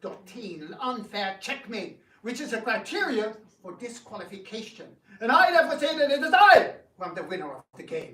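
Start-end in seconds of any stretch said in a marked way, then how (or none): 5.2-8.1 fast, loud self-declared victory; blustering fast proclamation of victory; pompous loud claim. Acted. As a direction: proclaim it over everyone.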